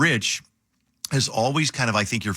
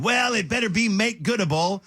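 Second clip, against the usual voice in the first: much higher-pitched imitation of a scolding boss; Rob; 12 semitones higher than usual. Host imitates boss calling in about missed commercial, scolding. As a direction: mimic a manager laying down the law. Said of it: "And you said".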